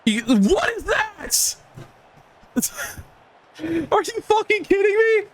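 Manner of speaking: high, incredulous rant at the game; outraged disbelief. Real, not acted. Gamer raging at the game over a bogus catch, high incredulous yell. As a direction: voice jumps way up in furious disbelief.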